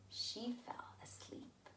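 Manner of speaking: soft whispered narration; sleepy whisper. A narrator's reading. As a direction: let it drift off like sleep. Storyteller lulls the sleeping scene.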